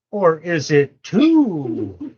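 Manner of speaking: slow playful drawl with a rise at the end; slow drawn-out echo. Pretend. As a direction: stretch each word out, teasing. Grandpa echoes child slowly.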